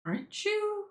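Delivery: high-pitched probing question; acted recognition, voice shooting up; character leaning in. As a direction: lean in with a rising, squeaky question. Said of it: "He gets surprised because his character leans in".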